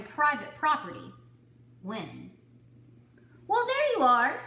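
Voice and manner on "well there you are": high, loud sing-song hello; cheery cow greeting. Acted. Cow greets the duck, high and loud. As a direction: greet him like an old friend.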